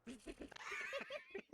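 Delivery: squeaky flustered laugh; high embarrassed laugh. Real, not acted.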